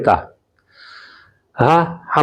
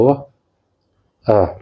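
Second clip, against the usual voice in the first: normal talking voice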